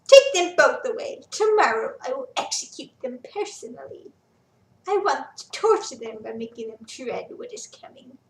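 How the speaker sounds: loud, gloating threat; cruel order. Acted. Quintus threatening the prisoners before execution, cruel. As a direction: promise them dread.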